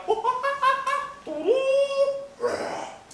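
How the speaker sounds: a very high, loud squealing animal noise. Shown as a demonstration. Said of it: "they read like a".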